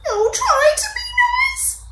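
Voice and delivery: a big lion whimpering in fear. Acted. Frightened lion begging the mouse, high whimper. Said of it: "he whimpered".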